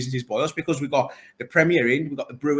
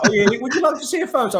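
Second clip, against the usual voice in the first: lightly put-on posh gallery-goer, higher on "would you like"; mock posh voice; 10 semitones higher, 4 dB louder than usual. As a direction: tease the posh visitors with a hammed-up polite offer. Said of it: "talking to all the posh people in the gallery".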